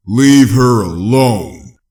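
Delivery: very loud, slow, drawn-out enraged roar; furious scream. Acted. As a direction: unleash it all on the ghoul. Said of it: "he screamed madly".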